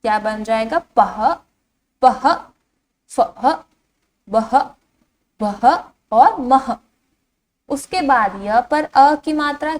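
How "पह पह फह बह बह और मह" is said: each of "पह, फह, बह, मह" said alone, ending in an h sound; visarga pronunciation. Shown as a demonstration. Teacher showing children the syllables, visarga pronunciation. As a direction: sound out "पह, फह, बह, मह" one by one with the final h. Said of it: "इसका उच्चारण किस तरह से किया जाता है".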